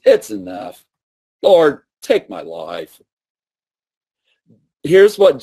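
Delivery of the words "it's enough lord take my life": much lower, worn-out plea; weary despair. Retold or imitated. Preacher voicing Elijah begging God to end it, low. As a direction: give up, pleading to die. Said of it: "And he even says".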